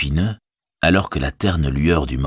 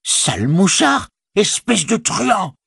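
much higher and slow, a furious frightened howl; howled in fear and rage; 13 semitones higher, slower than usual. Acted. Orc tracker howling insults at the soldier, furious and afraid. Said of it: "hurla-t-il".

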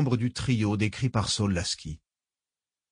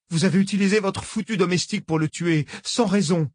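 angry accusation; 8 semitones higher than usual. Acted. Nathalie accuses, fighting tears.

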